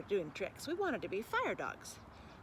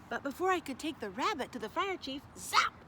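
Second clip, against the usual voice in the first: ordinary delivery, nothing marked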